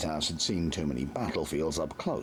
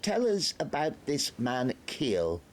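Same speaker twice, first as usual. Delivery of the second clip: higher, slow pompous officer; probing Major; 10 semitones higher, slower than usual. A narrator's reading. The Major questions Nobby, pompous. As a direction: lean in and demand.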